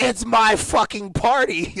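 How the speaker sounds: angry outburst. Retold or imitated. Retelling yelling at the security guard, slow angry shout. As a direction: yell it at the bouncer, slow and hot. Retold quarrel, voice raised.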